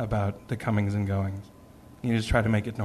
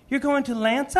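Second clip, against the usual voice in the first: imitating an eager five-year-old; energetic five-year-old; 13 semitones higher than usual. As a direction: ask like an excited small boy. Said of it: "all of his five-year-old energy".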